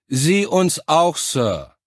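loud, high, slow military response. A narrator's reading. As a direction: shout it back to the officer.

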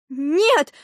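high, loud cry of refusal; sudden cry. A narrator's reading. Girl refuses the fat stranger, sudden cry. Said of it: "закричала вдруг Алиса".

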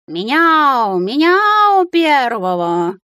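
высокий, громкий, протяжный кошачий вой; взвой котом, растягивая гласные; воющий кот. A narrator's reading. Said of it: "взвыл из сумки Филя". (протяжно, по-кошачьи взвыть)

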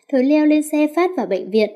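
usual speaking voice

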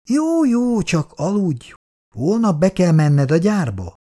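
put-on complaining old wife, mildly put upon; lightly voiced elderly wife grumbling at night. A narrator's reading. Szabó néni's voice.

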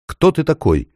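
put-on high, scared boy asking; frightened question. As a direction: ask nervously into the dark. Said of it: "наконец спросил".

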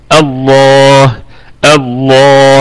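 "الله" with a thick, dark lam and long vowel; heavy lam. Shown as a demonstration. Teacher demonstrating tafkheem, "الله" with heavy, held lam. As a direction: fill out and hold "الله". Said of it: "هكذا".

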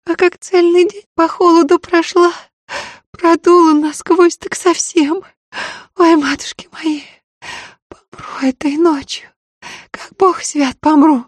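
high, pained voice through tears; girl with toothache. Acted. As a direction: whimper it, aching jaw, near crying. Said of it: "Девка едва говорила сквозь слёзы".

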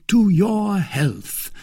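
high, drawn-out toast. Acted. Porthos and Aramis toast D'Artagnan, high and drawn out. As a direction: raise the glass with a high, slow flourish.

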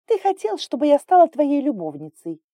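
very high, loud outburst; outraged exclamation. A narrator's reading. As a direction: blurt it out, unable to hold back. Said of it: "воскликнула Инга, не сдержавшись". (несдержанный возмущённый выкрик высоким голосом)

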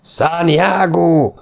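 long, slow, high war cry; battle cry. A narrator's reading. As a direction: stretch the war cry out slowly. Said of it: "visszhangzott a kiáltás". (elnyújtott, magas csatakiáltás)

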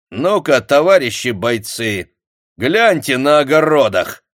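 commanding shout to the soldiers; loud order. A narrator's reading. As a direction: order them to search. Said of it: "приказал Самойлов". (громкий приказ)

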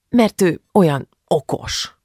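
lightly voiced sulk, dragged out; offended mumbling. A narrator's reading. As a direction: grumble it, still hurt. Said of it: "motyogta Julcsi még mindig sértődötten".

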